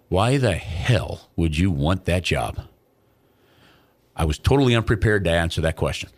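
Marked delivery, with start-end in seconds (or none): 0.1-2.6 low, slow imitation of a tall hockey executive; gruff GM voice. Mark Sauer towering over him, low slow put-on voice. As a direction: look down at him and drawl the question. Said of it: "And he goes".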